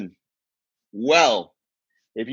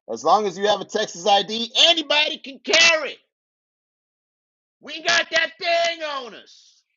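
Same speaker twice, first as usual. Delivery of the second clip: a hammed-up proud gun-owner shout, much higher; mock Texan boast; 10 semitones higher than usual. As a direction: brag like a swaggering Texan.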